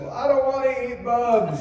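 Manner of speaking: hammed-up wife refusing; wife's voice.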